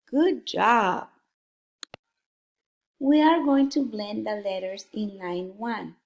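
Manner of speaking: ordinary talk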